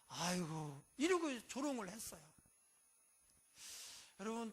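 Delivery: ordinary talk, nothing marked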